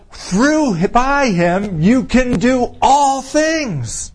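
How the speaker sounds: high, slow, ringing declaration; triumphant. Real, not acted. Preacher promising power through Christ, triumphant. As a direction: lift and stretch it in triumph. Triumphant climax of the scripture point.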